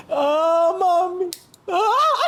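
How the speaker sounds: ordinary delivery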